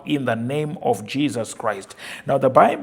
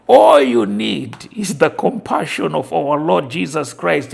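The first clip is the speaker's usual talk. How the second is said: loud, higher fervent declaration; preaching at full force; 3 semitones higher, 7 dB louder than usual. Acted. Preacher declaring life over the congregation, full force. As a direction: pour everything into the proclamation.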